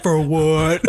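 grunting dumb caveman. Retold or imitated. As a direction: say it like a dim caveman. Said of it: "you make Archie sound like a caveman".

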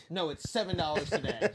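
loud, flat refusal to budge; retold defiant reply. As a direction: say it loud and stubborn. Coworker defiantly overcharges customer.

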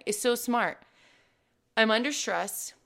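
ordinary delivery

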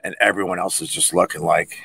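ordinary talk, nothing marked

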